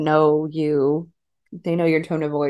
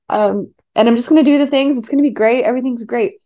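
higher, relentlessly chipper and bright-sided; Sister Mary Sunshine; 5 semitones higher, 5 dB louder than usual. Retold or imitated. Bride reassuring worried friends, forced sunny cheer. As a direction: sing out forced cheer like nothing is wrong. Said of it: "Sister Mary Sunshine mode".

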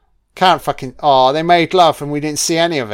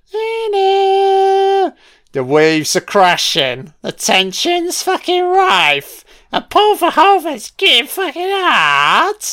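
much higher, hammed-up trailer voice; sarcastic swooning narrator, pitched way up; mock dramatic voice; 4 dB louder, slower than usual. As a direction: ham up the steamy scene, high and slow.

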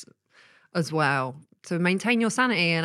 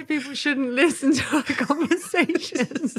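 high-pitched words broken by giggles; laughing hard; 9 semitones higher than usual. Real, not acted. Wife giggling over their podcast conversation, laughing hard. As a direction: squeal it out through real laughter.

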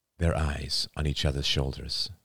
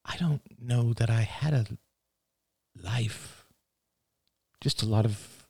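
higher, halting, faraway voice; daughter character, distant; 7 semitones higher than usual. Acted. Daughter reflecting on her life to father, distant. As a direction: drift through it with long pauses.

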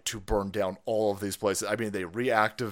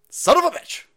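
high, loud cursing outburst; exasperated yell; 9 dB louder than usual. Acted. Host cursing a co-worker with the night off, exasperated yell. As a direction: yell the curse in frustration. Yelling at absent producer.

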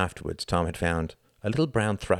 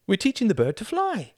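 put-on high, gleeful girl's voice; laughing girl; 4 dB louder than usual. A narrator's reading. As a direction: answer high and lightly amused. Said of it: "Elizabeth laughed".

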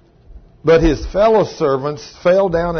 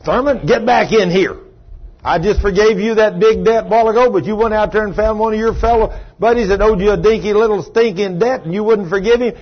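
raised, louder scolding; angry lord; 5 semitones higher, 3 dB louder than usual. Acted. King calling the unforgiving servant back in, angry scolding. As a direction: dress down a servant, higher and forceful. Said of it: "He said he was angry".

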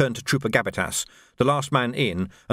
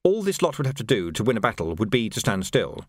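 usual speaking voice

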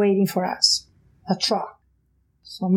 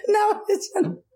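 much higher, laughing indignant protest; laughing outrage; 12 semitones higher than usual. Retold or imitated. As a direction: squeal with laughing disbelief.

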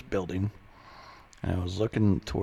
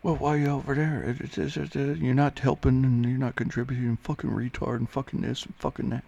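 mildly mock-angry, higher-pitched ranting; trash-talking teammate; 6 semitones higher than usual. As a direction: imitate a nagging teammate piling on complaints. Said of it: "starts talking shit to me".

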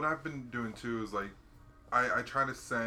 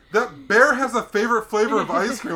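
much higher and louder marveling; amazed caller; 10 semitones higher, 15 dB louder than usual. Retold or imitated. Owner insists the bear has a favorite, loud. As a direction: proclaim it like wild news. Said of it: "He goes".

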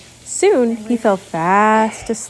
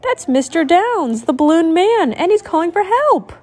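put-on higher, eager girl's voice; little sister; 8 semitones higher than usual. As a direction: correct her brother. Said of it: "said his sister".

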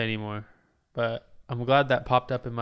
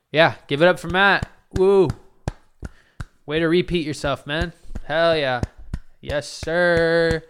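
mock hype cheer; 5 semitones higher, 6 dB louder than usual. Cheering himself for repeating himself.

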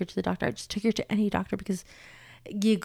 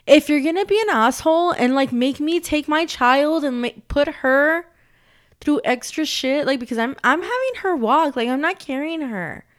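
loud, higher, heated rant; real anger; 6 semitones higher, 8 dB louder than usual. Real, not acted. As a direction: vent the fury at the clinic. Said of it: "you guys, I'm mad".

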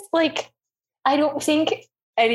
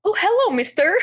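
mock polite greeting; 10 semitones higher than usual. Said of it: "be like".

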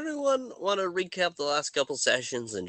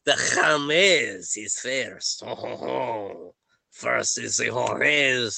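put-on French accent; 3 semitones lower than usual. Game master guesses the order of players, halting French accent. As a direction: list names haltingly in a mock French accent. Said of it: "am I to continue with it".

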